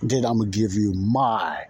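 normal talking voice

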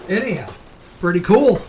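ordinary delivery

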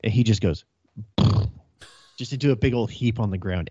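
usual speaking voice

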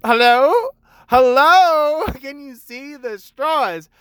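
high, loud, laughing exasperated hello. Retold or imitated. Barista to customer who can't see the straws, exasperated and laughing. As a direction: wave down someone blind to the straws. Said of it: "And it's like".

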